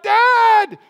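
loud, high-pitched cry for help. Acted. Small child calling Dad for help, crying out. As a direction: shout it like an overwhelmed child calling a parent. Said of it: "They cry out".

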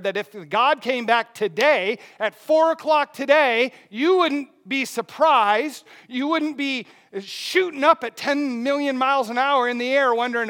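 ordinary talk, nothing marked

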